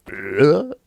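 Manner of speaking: high, rising mock "ugh"; gut-punch grunt. Shown as a demonstration. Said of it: "like getting punched in the gut".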